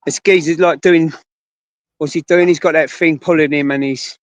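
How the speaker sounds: usual speaking voice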